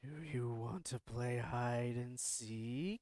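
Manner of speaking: put-on very slow, low eerie child; creepy ghost voice. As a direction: drawl it out like a ghost. Ghost speaks from the closet.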